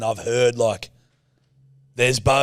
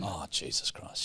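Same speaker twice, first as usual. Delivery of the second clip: mock whisper; 8 semitones lower, 16 dB quieter, slower than usual. Mock fear of angry cohost.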